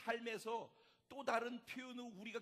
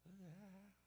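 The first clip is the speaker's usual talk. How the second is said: very soft "aah"; a small cry retreating into hiding; the battle cry turned right down; shrinking, muted cry; 11 dB quieter, much slower than usual. Acted. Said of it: "하고서 숨어 들어갔단 말이에요".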